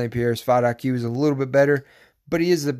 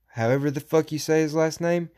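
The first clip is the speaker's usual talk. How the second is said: ordinary delivery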